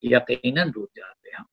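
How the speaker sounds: slow measured couplet delivery; poetry recitation. Acted. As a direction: recite the verse with feeling.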